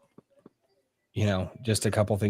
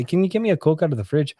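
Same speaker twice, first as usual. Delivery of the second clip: higher-pitched Irish-sounding Newfoundland lilt, retold; mom's Newfoundland accent; 7 semitones higher, 4 dB louder, faster than usual. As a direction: imitate mom slipping into a Newfoundland accent. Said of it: "the accent would seep into".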